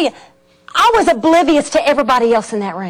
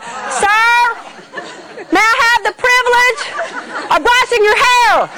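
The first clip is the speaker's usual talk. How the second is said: speaking up; 8 semitones higher than usual. Retold or imitated. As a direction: speak up so he hears. Told to speak up.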